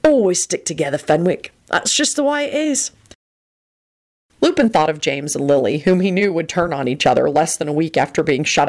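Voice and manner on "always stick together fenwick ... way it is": superior tone, rising high at the end; lofty. A narrator's reading. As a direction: declare it loftily as settled fact. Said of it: "Sirius replied loftily".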